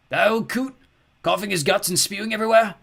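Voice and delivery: high, loud cracked sneer; sneering creature voice. Acted. Skellig scoffing at Ernie, cracked sneer. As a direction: scoff at the old man like a grouchy creature.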